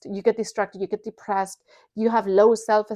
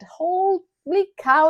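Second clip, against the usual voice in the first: drawn-out exclamation; 7 semitones higher, 3 dB louder, much slower than usual. Real, not acted.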